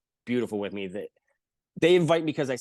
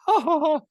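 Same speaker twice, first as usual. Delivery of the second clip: mocking laugh; 14 semitones higher, 7 dB louder than usual.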